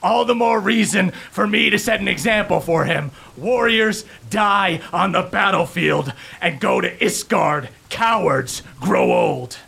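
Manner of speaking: proudly sets an example for his son; proud warrior. Acted. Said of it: "And Elias goes".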